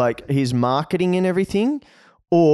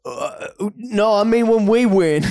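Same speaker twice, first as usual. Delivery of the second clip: higher, lightly voiced Biden backtracking; flustered backtrack, voiced higher; mock Biden; 7 semitones higher than usual. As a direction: fumble the correction like Biden. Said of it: "then he backtracks and is like".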